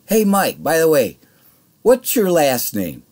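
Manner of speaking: baiting the boss across the counter; mock-sweet callout. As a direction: call him out sweetly loud. Calling out the boss.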